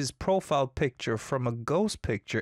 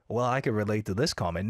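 ordinary delivery

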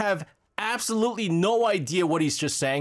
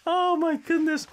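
high oh my goodness; exasperated; 11 semitones higher than usual. Real, not acted. Host reacting to [name]'s cover, high and exasperated. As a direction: throw it up high in disbelief.

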